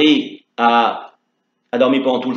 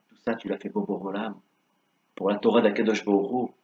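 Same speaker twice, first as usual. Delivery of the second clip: tender blessing; 4 semitones lower, 9 dB quieter than usual. Acted. Le rabbin bénit Chaya.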